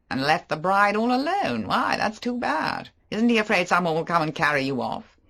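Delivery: flirtatious mocking banter; Favell. Favell voiced.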